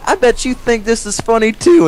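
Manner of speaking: high-pitched accusing imitation; mock indignation. Retold confrontation of laughing coworkers.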